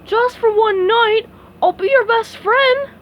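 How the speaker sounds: pleading. A narrator's reading. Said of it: "he pleaded".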